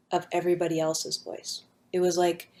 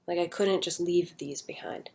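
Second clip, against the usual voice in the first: whisper; 6 dB quieter than usual. Real, not acted. Confiding near-death feelings.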